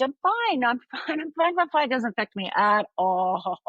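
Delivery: high, laughing mock reassurance; acted denial. Outside self hiding the trauma, high laughing mock denial. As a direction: laugh it off too insistently, putting on a front. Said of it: "the outside me was fine".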